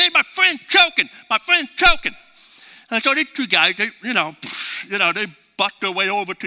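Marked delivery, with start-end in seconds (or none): 0.0-2.1 high, loud panicked call for help; alarmed shout. Retold or imitated. Friend shouting to police officers, loud and panicked. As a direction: yell it across the room. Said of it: "he runs over and says".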